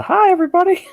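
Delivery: strained attempt at a very high squeaky voice; put-on character voice. Co-host greeting listeners in a character, failing high put-on voice. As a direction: jump way up high for a cartoonish greeting. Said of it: "I can't do the voice".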